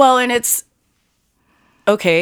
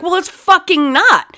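much higher and louder, slow furious retort; retold angry yell; 11 semitones higher, 5 dB louder than usual. Telling off the woman keying her car, retold yell. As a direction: snap it back like the car's yours. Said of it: "And I was like".

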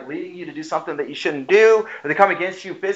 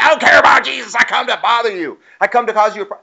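higher, pushy voice for a sneering troublemaker; mock hostile unbeliever; 6 semitones higher, 3 dB louder, faster than usual. A preacher voices an unbeliever mocking his congregation, sneering and pushy. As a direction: sneer it like someone spoiling for a fight.

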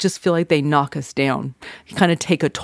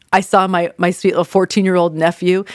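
normal talking voice

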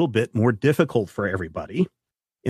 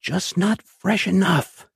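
lightly voiced creepy man, softly and slowly; a hint of a soft, slow sinister stranger; 7 semitones higher, slower than usual. Pale man leaning over the dying player, soft and slow. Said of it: "in a soft voice".

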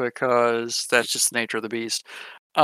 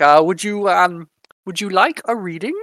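put-on German accent; 5 semitones higher, 5 dB louder than usual. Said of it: "I don't know why he's German all of a sudden".

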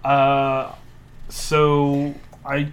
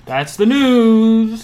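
announcer sign-off; 8 semitones higher, 11 dB louder, much slower than usual. Pretend. News sign-off.

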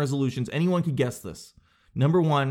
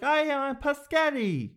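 high, drawn-out mock toddler voice; little kid; 13 semitones higher, slower than usual. Evan dodging the question with pasta, high kid squeal. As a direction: squeal it slowly, way up in pitch. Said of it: "even if he's like".

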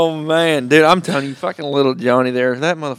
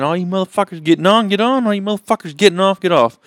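put-on kid train conductor; 6 semitones higher than usual.

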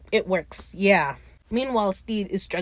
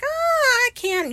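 drawn-out high "Ah" of refusal; mock regretful refusal; slower than usual. Fang telling Lucius he can't help, mock regretful. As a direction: imitate someone begging off in a high voice.

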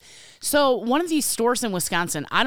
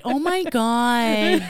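slow, stretched mock Illinois accent; hammed-up Illinois exasperation; exaggerated Illinois drawl; 3 semitones higher, much slower than usual. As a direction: drag the vowels out, Illinois style. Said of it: "why do I talk like that".

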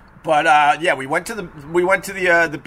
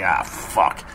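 much lower, muttered groan of annoyance; resigned curse; 11 semitones lower than usual. Retold or imitated. Dog owner seeing teller return, low muttered resigned curse. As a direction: sigh it out, deflated. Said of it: "he's like".

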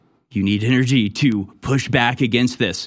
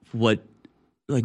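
usual speaking voice

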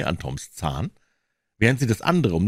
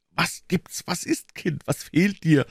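anxious questioning; 11 semitones higher than usual. Acted. Alarmed aunt questions sick child.

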